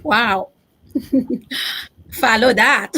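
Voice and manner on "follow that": laughing through the words. Real, not acted. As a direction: joke while laughing. Host laughs after moving speech.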